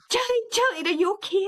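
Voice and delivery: high, anxious call on the phone; worried caller. Retold or imitated. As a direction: call out her name in alarm. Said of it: "they're like".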